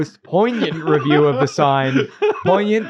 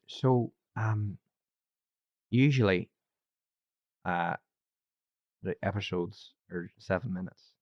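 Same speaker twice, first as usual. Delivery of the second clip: soft, halting Irish-accent imitation; attempted Irish accent; 4 semitones lower, 9 dB quieter than usual. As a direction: quietly imitate an Irishman, with long pauses. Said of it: "I'll do his accent".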